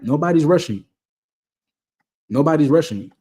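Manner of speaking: nobody is rushing you, rushing pressed hard; stressing rushing. Shown as a demonstration. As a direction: lean on rushing, reassure them. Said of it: "All right?".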